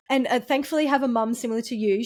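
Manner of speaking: ordinary talk, nothing marked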